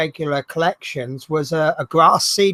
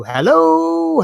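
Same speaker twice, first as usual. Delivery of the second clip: one big long hello, higher and louder; phone hello calling; 10 semitones higher, 4 dB louder than usual. Shown as a demonstration. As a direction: stretch the hello out like shouting down a bad line. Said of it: "when you're on the mobile phone and someone can't hear you".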